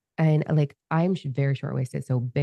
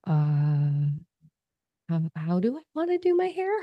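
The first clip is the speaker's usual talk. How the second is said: lost, bewildered self. Retold or imitated. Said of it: "you're like".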